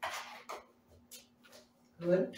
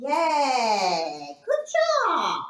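squeaky praising a dog; 4 dB louder than usual. Real, not acted. Praising dog after trick.

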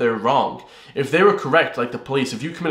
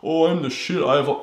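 mock cocky; 2 semitones higher than usual. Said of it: "Not in a cocky asshole way".

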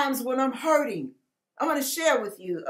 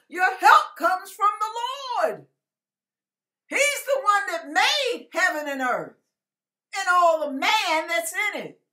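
preaching at full force; 6 semitones higher than usual. Real, not acted. Preacher urging his viewers to turn to God, fervent and rising. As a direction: lift them up with rising fervor.

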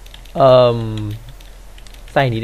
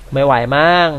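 ordinary talk, nothing marked

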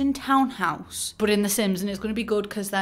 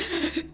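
retold gasp; 9 semitones higher than usual. Rang mum, scared.

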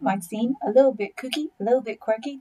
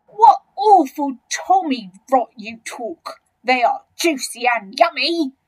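loud, higher, dismissing the nonsense; big crocodile relishing his argument; enormous crocodile, scornful; 3 semitones higher, 7 dB louder than usual. Acted.

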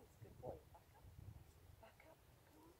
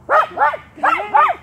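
calling out; 13 semitones higher, 20 dB louder than usual. Real, not acted. Calling dog round the course.

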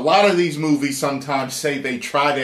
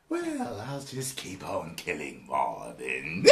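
villain voice; 10 semitones lower, 15 dB quieter, slower than usual. Retold or imitated. Voicing the villain's reply.

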